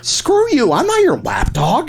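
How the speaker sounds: high, indignant retort; indignant outburst at the co-host; angry refusal. Acted. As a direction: snap back, insulted. Quarrel over doing the accent.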